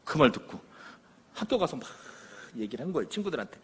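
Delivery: ordinary talk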